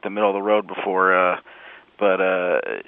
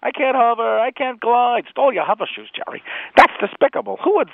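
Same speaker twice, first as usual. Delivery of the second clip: high, whiny sitcom complaint; high indignant Seinfeld whine; Jerry Seinfeld voice; 13 semitones higher, 3 dB louder than usual. Acted. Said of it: "it's Jerry comes in".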